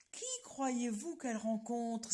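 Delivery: usual speaking voice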